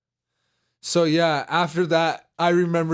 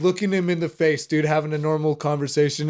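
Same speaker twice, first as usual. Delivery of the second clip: normal talking voice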